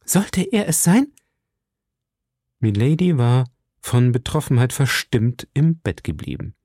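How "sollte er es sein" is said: high, hopeful question rising at the end; breaking out in eager hope; excited cry. A narrator's reading. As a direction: ask it eagerly, pitched way up. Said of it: "rief Madame Bonacieux und stürzte ans Fenster".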